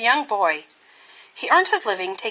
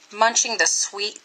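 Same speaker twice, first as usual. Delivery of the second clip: ordinary delivery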